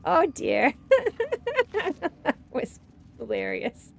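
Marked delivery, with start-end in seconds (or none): 0.8-2.2 high-pitched burst of laughter. Real, not acted. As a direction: laugh out loud. Laughs at fail.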